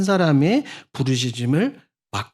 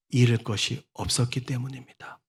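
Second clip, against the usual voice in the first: hushed emphasis; 3 semitones lower, 7 dB quieter than usual. Real, not acted. Softened emphasis.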